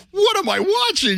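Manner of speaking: high, drawn-out incredulous cry; mock astonishment. Retelling the rugby's last five minutes to co-hosts, mock astonishment. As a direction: squeal it slowly in disbelief, hamming it up. Acts out own amazed reaction.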